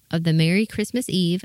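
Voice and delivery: usual speaking voice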